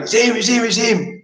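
imitating a doorman raising the alarm. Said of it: "the doorman was going".

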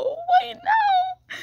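high, drawn-out whimpering wail; whiny wail. Real, not acted. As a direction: cry out at the failing Wi-Fi.

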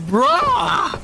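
high exasperated shout of bruh. Real, not acted. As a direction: yelp it in disbelief. Exasperated at the camping enemy.

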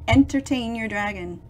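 plain reading voice

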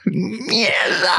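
high sudden cry of a swear; mock swearing outburst. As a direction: blurt it out like a tic. Acting out swearing tic.

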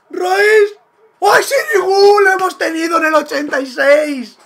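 high, loud cry at a missed chance; anguished shout. Real, not acted. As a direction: yell in despair at the miss. Near miss on a late chance.

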